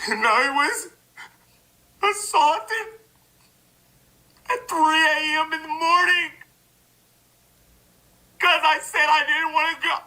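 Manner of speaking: high, whiny mock victim voice; mock the women accusing him; mock accuser voice. Mocking the accusers in a high whiny voice.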